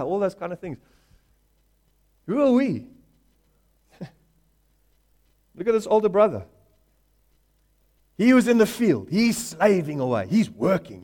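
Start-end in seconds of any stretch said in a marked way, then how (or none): none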